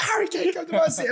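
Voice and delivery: high, loud imitation of fans shouting at a star; fans calling out. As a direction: yell it like a cheeky fan. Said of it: "We're like".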